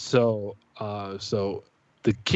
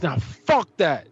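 higher, drawn-out angry refusal; retold hot temper; 6 semitones higher, slower than usual. Dad brushes off his wife's telling him to chill, hot-tempered. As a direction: re-enact blowing up, slowly and emphatically. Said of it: "So I'm hot".

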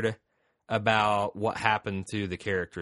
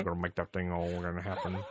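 mumbled, run-together Southern cartoon voice; Boomhauser; 4 semitones lower, 7 dB quieter than usual. Host doing a King of the Hill character, mumbled Southern babble. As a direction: ramble it in garbled hillbilly mumble.

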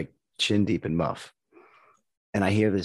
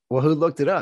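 higher and louder, mock indignant; incredulous question; 7 semitones higher, 6 dB louder than usual. Questioning the neighbors, higher louder mock indignation. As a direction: demand to know who did it. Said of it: "and I was like".

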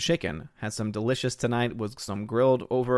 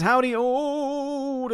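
high, long-drawn howdy; hammed-up greeting; 13 semitones higher, much slower than usual. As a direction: stretch the greeting out high and slow. Reading a viewer's drawn-out greeting aloud.

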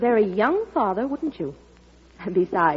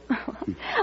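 delighted giggle; 3 semitones higher, 8 dB quieter than usual. Acted.

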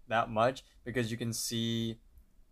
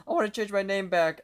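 quick, higher, louder acted plea; hurried demand; 9 semitones higher, 5 dB louder, faster than usual. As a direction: blurt it out fast and high. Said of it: "immediately gets to the point".

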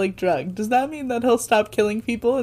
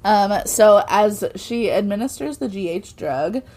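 usual speaking voice

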